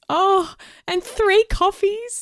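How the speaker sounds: delighted. Real, not acted. Delighted reading out a donation.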